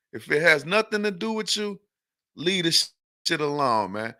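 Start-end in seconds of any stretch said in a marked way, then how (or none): none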